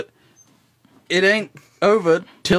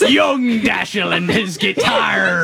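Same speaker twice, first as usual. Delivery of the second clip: slow, drawn-out pirate bellow; 3 semitones higher, slower than usual. Acted. Introducing himself on radio as a joke, hammed-up pirate. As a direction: stretch every word like a hammy pirate. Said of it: "try to do a pirate voice".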